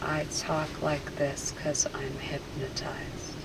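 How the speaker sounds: soft, slow, obedient repetition; entranced echo. Real, not acted. Subject repeating the hypnotist's suggestion, softly entranced. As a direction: murmur it back as if in a trance.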